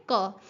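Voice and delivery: "क" alone as a short "kuh"; the letter sound "क", not the name "see"; isolated sound. Shown as a demonstration. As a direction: sound out just the opening "क" of cat. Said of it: "किस चीज़ की sound आ रही है".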